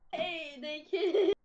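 shocked laughter. Real, not acted. Laughing reaction.

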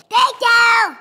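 loud excited thank you; shouting. Real, not acted. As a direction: shout it out happily. Kid thanks for the drawings.

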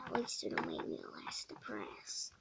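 very low, whispered, deflated aside; gloomy whisper. Acted. Depressed kid muttering to himself, whispered. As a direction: mutter it under your breath in gloom.

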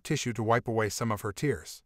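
ordinary delivery

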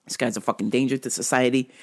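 usual speaking voice

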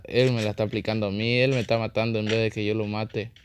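ordinary delivery

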